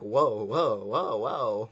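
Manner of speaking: a hint of a lecherous alien moan; mock moaning whoas, lightly voiced; lightly hammed-up creature moaning; imitating Itchy. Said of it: "you see him, like, going".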